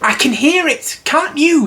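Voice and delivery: very high, nervous squeak; whisper-worried little pig voice, high; anxious Piglet. Acted. Piglet nervously asking Christopher Robin, very high squeak. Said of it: "said Piglet anxiously".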